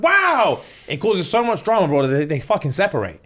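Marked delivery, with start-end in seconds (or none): 0.0-0.6 high, loud stunned wow; shocked wow. Retold or imitated. Girlfriend stunned by boyfriend's insults, shocked. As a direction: gasp it out in disbelief.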